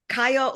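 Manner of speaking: "Kayo" said as KAI-oh; pronunciation option Kayo. Shown as a demonstration. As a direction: say "Kayo" opening with an eye sound. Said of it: "confused whether it's Kio".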